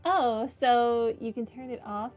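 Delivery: usual speaking voice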